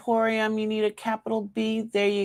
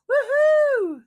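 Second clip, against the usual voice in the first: high whooping cheer; excited whoop; 4 dB louder than usual. Real, not acted. Host to live viewers, cheering the draw with a high whoop. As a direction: whoop with delight.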